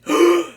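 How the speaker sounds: sharp rising gasp; shocked gasp. Acted. Villain hearing the hero's ringtone, sharp shocked gasp. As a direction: catch your breath at the discovery.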